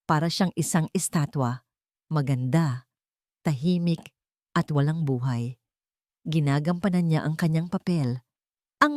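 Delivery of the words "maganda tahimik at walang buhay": ordinary delivery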